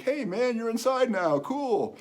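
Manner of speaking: high-pitched imitated greeting; friendly greeting. Young man bumping into stranger in store, friendly greeting. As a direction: voice the other man hailing him.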